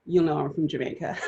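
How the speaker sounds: ordinary talk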